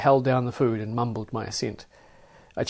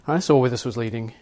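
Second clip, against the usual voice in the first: ordinary delivery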